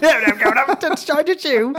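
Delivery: high, loud, drawn-out fake British; mock British accent. Answering request for British accent, high mock British. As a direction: ham up a bad British impression. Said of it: "impression of".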